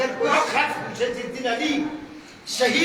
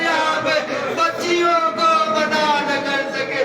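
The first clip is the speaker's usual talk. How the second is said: raised, chanted lament told to the mourners; mournful majlis recitation; 4 semitones higher than usual. Acted. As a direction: wail the story out as a grieving chant.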